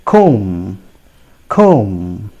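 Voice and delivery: "comb" as "kome", silent b; low, drawn-out "comb" ending on m; correct pronunciation. Shown as a demonstration. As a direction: model "comb" twice with long o, no b. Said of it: "It is pronounced as".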